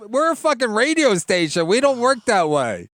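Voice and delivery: high, loud mock indignant boss; mock station boss. Mocking the station boss refusing the wife's demand. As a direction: play the outraged radio manager.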